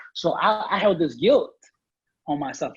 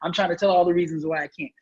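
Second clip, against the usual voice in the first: normal talking voice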